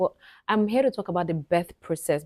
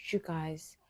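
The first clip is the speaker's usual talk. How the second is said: whisper; 2 semitones lower, 10 dB quieter than usual. Real, not acted. Baby finally came out.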